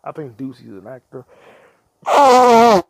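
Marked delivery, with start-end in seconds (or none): 2.1-2.8 one word blurted very loud and high; sudden yell. Real, not acted. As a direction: blast the word out. Sudden yelled word while blowing nose.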